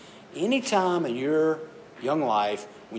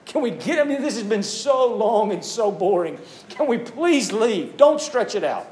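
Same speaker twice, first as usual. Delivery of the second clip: put-on whiny kid; 6 semitones higher than usual. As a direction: beg like a bored kid who wants the car keys. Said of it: "something like".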